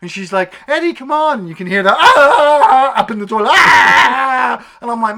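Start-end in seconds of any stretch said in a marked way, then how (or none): 0.7-1.5 high, loud mock urgency; urgent call. Leslie urging Eddie into the car, urgent shout. 2.0-2.9 loud, high imitated wail; mock monster scream. Imitating Sammy up in the toilet, mock scream. 3.5-4.8 long, very loud imitated scream; mock monster scream. Imitating Sammy still in the toilet, long mock scream.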